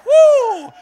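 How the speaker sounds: very high, loud whoop; shocked congregation member, a high loud whoop. Retold or imitated. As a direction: let out a startled woo. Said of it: "You're like".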